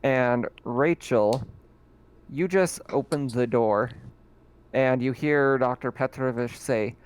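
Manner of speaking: ordinary delivery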